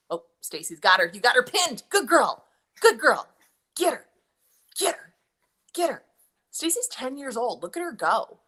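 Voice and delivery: loud, high cheering on her daughter; root for her like a ringside fan; excited cheering. Real, not acted. Mom cheering her daughter pinning her opponent, loud and high.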